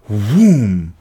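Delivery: loud booming whoom; explosion whoom. A narrator's reading. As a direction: blast the sound out like an explosion. Explosion sound in narration.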